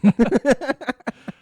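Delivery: loud, high cackle; loud laugh. Real, not acted. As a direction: burst out laughing loudly. Laughs at own joke.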